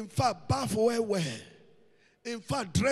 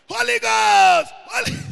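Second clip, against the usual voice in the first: slow fervent shout; 6 semitones higher, 8 dB louder than usual. Shown as a demonstration. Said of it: "demonstrating third call".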